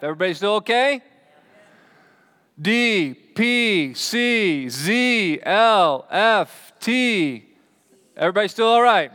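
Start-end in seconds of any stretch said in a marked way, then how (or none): 2.6-7.4 slow, raised, drawn-out letters; calling out letters. Shown as a demonstration. Preacher testing the congregation's eyes on a chart, calling out slowly. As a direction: call each letter out separately, high and long.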